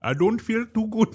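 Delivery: high-pitched attempt at an Indian accent; badly faked Indian accent; mock Indian accent.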